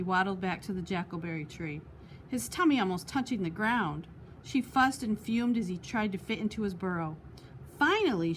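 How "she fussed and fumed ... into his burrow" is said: ordinary delivery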